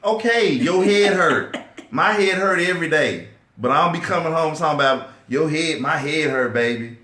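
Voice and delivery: high, loud, laughing mock of his old self; mock dismissive husband. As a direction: ham up the tough guy brushing her off. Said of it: "I would just be like".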